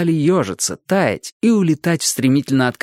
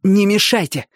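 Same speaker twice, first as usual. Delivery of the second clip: tense, higher-pitched brush-off; nervous; 7 semitones higher than usual. A narrator's reading. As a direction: nervously warn the others away. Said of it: "нервно сказал Лыж".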